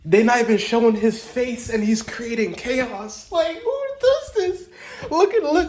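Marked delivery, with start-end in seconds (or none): 3.3-5.7 awed squeal. Real, not acted. Fan gushing over the villain, high awed squeal. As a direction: squeak it out in awe.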